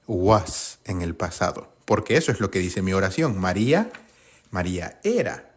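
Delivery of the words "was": was said slowly and set apart; stress on was. Shown as a demonstration. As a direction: lean on was as the key word. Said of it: "va con el".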